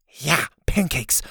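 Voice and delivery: drawn-out "Yeah", then a falling "pancakes"; confirming. Acted. Confirming the odd detail.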